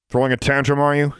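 guard mocking a girl's tantrum, sneering. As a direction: sneer down at her. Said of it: "he sneered".

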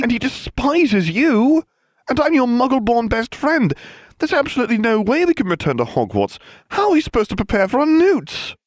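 high-pitched, mounting panic; frantic rant. A narrator's reading. Friend panics over returning to school, frantic. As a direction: wail out the worries, high and agitated.

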